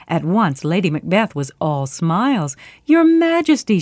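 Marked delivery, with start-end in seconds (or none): 2.8-3.8 much higher, louder gushing welcome; cried out greeting. Acted. Lady Macbeth greets the king, gushing and bright. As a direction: throw it up high, all smiles. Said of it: "she cried".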